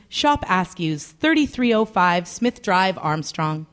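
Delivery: ordinary talk, nothing marked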